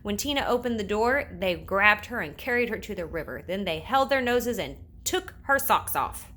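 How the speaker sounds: usual speaking voice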